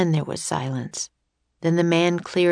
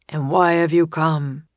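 lightly gruff, commanding man; mildly gruff questioning voice; deep gruff voice. A narrator's reading. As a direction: demand an answer with a touch of gruffness. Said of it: "asked the voice".

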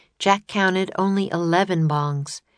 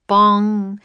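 mock bell bong; 3 semitones higher, 7 dB louder than usual. A narrator's reading. Clock striking.

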